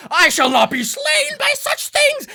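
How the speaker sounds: high, loud stage-villain protest; indignant Boo imp. Acted. GM as Boo imp with knife in chest, hammy defiance. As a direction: declaim it in a squeaky, overblown voice. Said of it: "the Boo imp".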